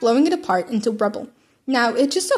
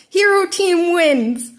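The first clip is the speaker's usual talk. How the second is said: slow, loud, crackly loudspeaker call; yelled over speaker; 7 semitones higher, 5 dB louder, much slower than usual. Acted. All Might announces the winner over a crackling speaker. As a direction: boom it through a crackling speaker. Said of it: "All Might yelled, voice crackling on the speaker".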